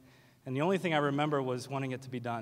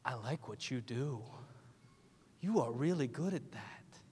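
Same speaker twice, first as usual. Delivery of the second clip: softer, slow gushing fan voice; a lightly voiced shy fan, softer; admiring fan; 7 dB quieter than usual. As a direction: softly gush compliments like a starstruck fan. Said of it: "they're gonna say something like".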